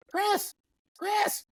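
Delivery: very high calling mom voice. Retold or imitated. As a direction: sing out the name like a thrilled mother. Mom calling son to phone.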